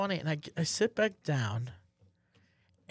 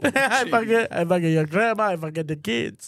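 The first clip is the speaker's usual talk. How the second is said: loud, chanting Italian priest send-up; hammed-up Italian priest; mock Italian accent; 2 semitones higher, 6 dB louder than usual. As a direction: repeat it like a frantic cartoon Italian, loudly.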